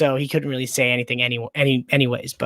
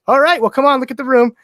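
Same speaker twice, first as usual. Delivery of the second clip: imitating the roommate, higher and louder; roommate's voice; 9 semitones higher, 6 dB louder than usual. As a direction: play the new roommate, higher, brushing it off.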